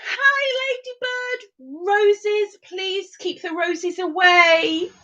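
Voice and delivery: high, drawn-out playful plea; hammed-up high-pitched chirp; silly high voice. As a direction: put on a high sing-out voice.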